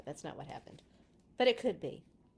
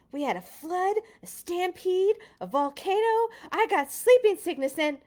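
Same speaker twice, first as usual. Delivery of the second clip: higher, louder, building eager list; excited child; 10 semitones higher, 9 dB louder than usual. A narrator's reading. Peter telling the grown-ups, excitedly much higher and louder. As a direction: rattle off the adventures, getting more excited. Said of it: "Peter was interrupted by the adults' laughter".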